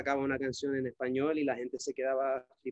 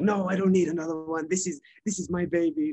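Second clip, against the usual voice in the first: protective refusal; 4 semitones higher, 5 dB louder than usual. Retold or imitated. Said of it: "'Cause I was like".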